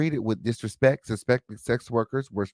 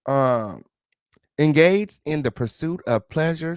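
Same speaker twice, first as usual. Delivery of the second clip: normal talking voice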